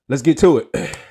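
rally the chat to begin; loud kickoff. Real, not acted. Streamer to chat, loud kickoff to start.

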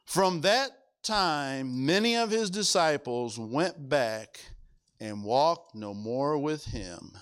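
slow, low and grave reading of the verse; solemn scripture reading. Acted. Preacher reading scripture to the church, slow and grave. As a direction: let the words fall heavy and slow.

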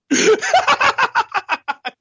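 loud, high helpless laugh; real giggling fit; high burst of laughter. Real, not acted. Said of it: "I'm laughing".

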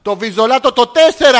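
loud, emphatic repeat; loud comic insistence. Pretend. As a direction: enunciate forcefully for the machine. Caller repeating loudly to phone system.

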